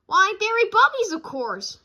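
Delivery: put-on high, loud gushing child voice; gushing cub. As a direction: gush over the toy. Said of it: "sister cub voice".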